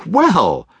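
high, lively voice, gently disbelieving; the kind nephew, surprised but friendly; cheerful young nephew. Acted. Nephew replying.

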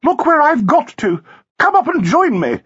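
high-pitched, lively woman's hail; gaily summoning someone over; sprightly calling out. A narrator's reading. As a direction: call out brightly, pitched well up. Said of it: "called out in a sprightly manner".

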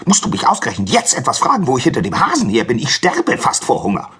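high, rushed, exasperated fox; harried, hungry fox voice; barking fox. Acted. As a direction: rattle off an irritated complaint. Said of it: "bellte der Fuchs".

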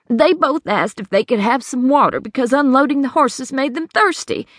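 Hattie's worried voice. Acted. Hattie explains to the sheriff how she lost money, anxious. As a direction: plead your story to the sheriff. Hattie recounts the two men.

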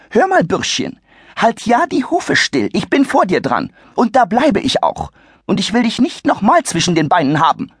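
haughty shrieky matron; scolding old lady. Acted. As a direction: put the brat in his place. Lady lectures Adi.

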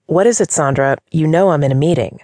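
ordinary delivery, nothing marked

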